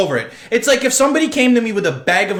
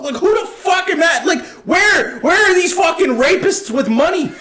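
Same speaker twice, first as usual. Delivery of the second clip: outraged shouting; 11 semitones higher than usual. Real, not acted. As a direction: shout your disbelief at the whole industry. Ranting about Hollywood producers.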